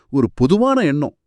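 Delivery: ordinary talk, nothing marked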